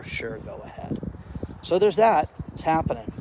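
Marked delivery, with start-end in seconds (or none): 0.0-1.0 mock whispered reply. Mock reply lending the car.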